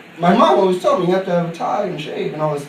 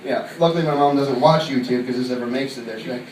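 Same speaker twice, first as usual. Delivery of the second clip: ordinary talk, nothing marked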